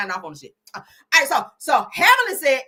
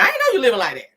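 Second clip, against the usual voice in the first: usual speaking voice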